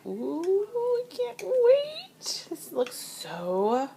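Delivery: high, slow, eager squeal; excited. Real, not acted. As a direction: stretch it out like you can't wait. About to taste.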